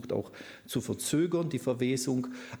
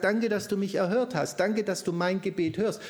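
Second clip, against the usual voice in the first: grateful prayer; 8 semitones higher, 4 dB louder than usual. Acted. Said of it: "Aber er sagt".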